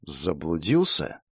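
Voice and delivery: low, slow menacing question; sneering panto villain. A narrator's reading. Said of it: "зловеще произнесла террористка".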